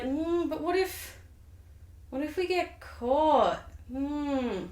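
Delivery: hesitant Macbeth. Acted. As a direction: draw it out, dithering and wary. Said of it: "And Macbeth's like".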